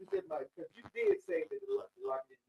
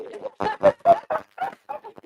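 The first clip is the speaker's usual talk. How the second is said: big burst of laughter; loud laugh; 13 semitones higher, 14 dB louder than usual. Real, not acted.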